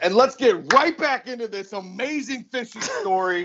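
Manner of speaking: high, sustained announcer pitch; big high-pitched show intro; mock announcer. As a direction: launch the segment like a show host.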